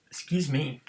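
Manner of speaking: apologizing after a burp. Real, not acted. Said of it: "Hiccuping, burping".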